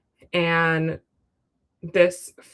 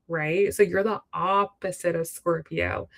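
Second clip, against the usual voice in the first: normal talking voice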